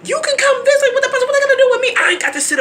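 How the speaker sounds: very high, fast, loud mocking tone; ridiculing a whiny relative's voice; mocking squeaky voice.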